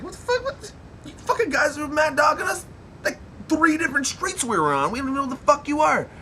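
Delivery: a high, furious yell; shouting. Retold or imitated. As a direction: scream it at the strangers, fast. Mike yelling at the car.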